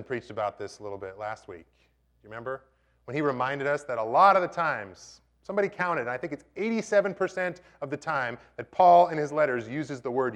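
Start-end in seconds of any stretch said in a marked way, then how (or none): none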